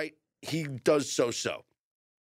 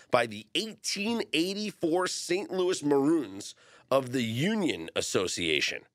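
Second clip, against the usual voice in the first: ordinary talk, nothing marked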